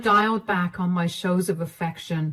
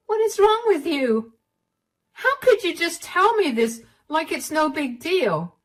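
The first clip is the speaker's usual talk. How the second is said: much higher, indignant cry; outraged; 8 semitones higher than usual. Acted. Wife confronting husband about his affair, outraged. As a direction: hurl it at him in disbelief.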